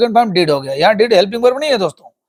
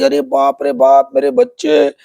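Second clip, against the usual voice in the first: mock wail; 6 semitones higher than usual. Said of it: "जोर से चिल्लाई".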